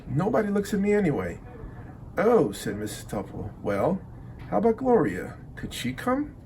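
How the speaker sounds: ordinary delivery, nothing marked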